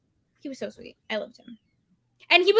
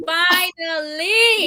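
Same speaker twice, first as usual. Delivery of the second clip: long, slow, loud finally; drawn-out cheer; 3 semitones higher, 9 dB louder, much slower than usual. Retold or imitated. Reader cheering the punch, slow and loud. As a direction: stretch it out in triumph.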